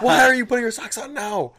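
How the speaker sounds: high and exasperated; high, disbelieving scold; incredulous. Retold or imitated. Quoted self, incredulous.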